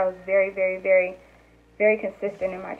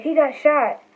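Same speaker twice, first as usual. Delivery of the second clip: alarmed; 7 semitones higher, 3 dB louder than usual. Retold or imitated. Announcing shooting at home.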